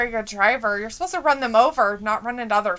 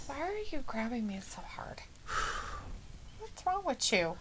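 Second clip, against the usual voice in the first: hushed whispered aside; a quiet whispered question; 20 dB quieter than usual. Real, not acted. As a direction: whisper it under your breath.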